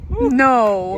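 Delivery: very loud, incredulous cry; shocked disbelief. Real, not acted. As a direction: burst out in disbelief at the snake story. Hears python fell from a car.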